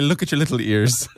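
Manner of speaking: lightly voiced Irish leprechaun; playful mock Irish through a laugh. Host giving his stock cue phrase, leprechaun accent. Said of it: "a leprechaun who's concerned with the size of someone's ears".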